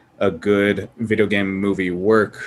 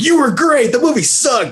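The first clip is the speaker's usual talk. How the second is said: higher, louder cheering crowd; crowd shouting; 4 dB louder than usual. Retold or imitated. Fans shouting praise at the actor, higher and louder. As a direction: call it out like an excited fan. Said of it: "They're just like".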